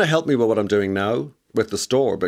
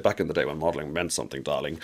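lower, softer take on a glamorous mother; imitating mother; 6 semitones lower, 7 dB quieter than usual. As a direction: quote your mother in a low, soft aside, dropping onto "darling". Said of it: "as she said".

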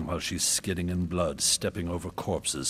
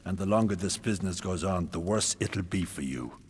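loud menacing warning; threatening; 2 semitones higher, 6 dB louder than usual. Acted. King threatening the queen about her son, loudly. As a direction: press her hard and loud.

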